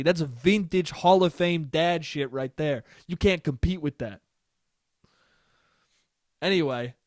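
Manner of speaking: ordinary delivery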